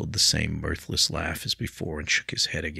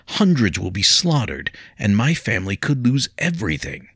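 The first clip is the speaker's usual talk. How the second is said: higher, louder anguished warning; distraught; 5 semitones higher, 6 dB louder than usual. A narrator's reading. William telling Leah of war, loud and distraught. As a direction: dread of the coming war.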